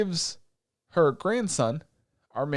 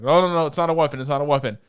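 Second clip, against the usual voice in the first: loud, hurried-sounding denial; head off a misunderstanding fast; acted alarmed protest; urgent reassurance; 6 dB louder, faster than usual. Voices chef hastily reassuring wary prince.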